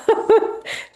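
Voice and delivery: high, breathy teary laugh; a laugh that is half a sob; emotional laugh breaking through. Real, not acted. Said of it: "I'm getting emotional".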